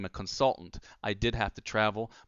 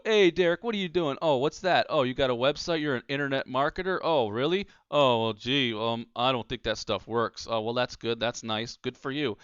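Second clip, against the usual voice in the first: skeptical acquaintance; 2 semitones higher, 3 dB louder than usual. Retold or imitated. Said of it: "and they go".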